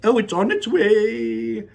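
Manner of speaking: high, slow, loud showman cry; Wonka crying out. Acted. Wonka reassures the guests, high and slow. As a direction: sing it out with delight. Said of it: "cried Mister Wonka".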